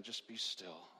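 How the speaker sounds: soft, slow soothing words; gentle reassuring whisper-soft voice of God; tender God. Retold or imitated. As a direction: comfort a crying child, hushed. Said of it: "And God's saying".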